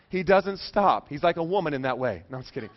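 normal talking voice

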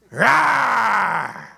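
drawn-out playful roar, falling; mock bear roar. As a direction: roar like the kid playing bear. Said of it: "you were growling at everybody".

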